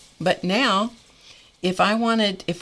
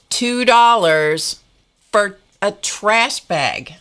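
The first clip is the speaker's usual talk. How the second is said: slow, loud and higher, hammering two dollars; losing control; 4 semitones higher, 7 dB louder, much slower than usual. Retold or imitated. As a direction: spell out the price in exasperation. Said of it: "I kind of lost control".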